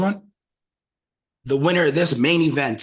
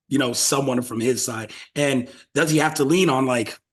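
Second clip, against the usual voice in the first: ordinary talk, nothing marked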